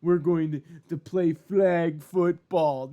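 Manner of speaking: sneering parody, stretched out slow at the end; mocking quote. Vlogger mocks flag football fans, sneering slow parody. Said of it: "Enough of this bullshit of".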